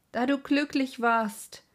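high, loud anguished outcry; exclaiming. A narrator's reading. Narrator bursting out after leaving the madman's mother, loud cry. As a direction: cry it out in despair. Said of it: "rief ich aus".

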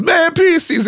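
sudden high-pitched "Man, Prius C's"; exasperated valet. Retold or imitated. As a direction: squeal it like a fed-up comic starting a bit. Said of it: "turns around and goes".

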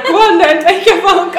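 hammed-up bouncer daring her to call; mock taunting promoter. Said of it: "he put his hand across the door like".

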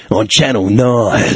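slow, drawn-out channel promo announcer; station-ident announcer impression; TV voiceover guy. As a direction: imitate an Australian TV voiceover. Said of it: "does voiceovers in Australia".

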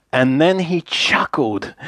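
usual speaking voice

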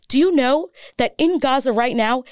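real impassioned challenge to the listener; ranting. Real, not acted. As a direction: keep ranting, punching each item on the list. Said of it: "I'm sorry I'm ranting".

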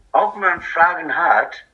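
ordinary talk

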